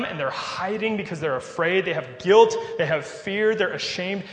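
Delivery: normal talking voice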